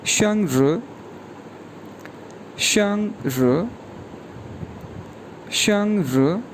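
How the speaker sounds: शंग र drawn out, slow and careful; model pronunciation. Shown as a demonstration. Teacher drilling the word शंग र for learners, slow. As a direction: repeat शंग र slowly for students. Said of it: "ये pronounce किया जाएगा".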